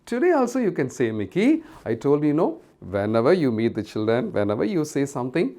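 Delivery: ordinary delivery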